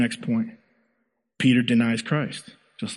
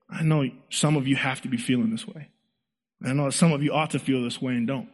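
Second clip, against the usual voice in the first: usual speaking voice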